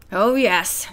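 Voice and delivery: plain reading voice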